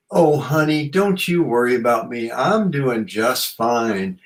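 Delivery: high, slow Southern American lilt; weird Southern American accent. Retold or imitated. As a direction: play Mother Earth as a folksy Southern auntie. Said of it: "in some sort of weird Southern American accent".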